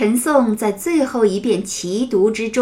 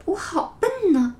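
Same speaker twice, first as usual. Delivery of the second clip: tearful self-blame; 7 semitones higher, 4 dB quieter than usual. Acted. Child sad thinking to himself.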